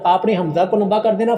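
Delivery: usual speaking voice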